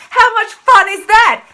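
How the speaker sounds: loud sarcastic jab; sarcastic question. Real, not acted. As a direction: tease them with it. Rhetorical jab about lying.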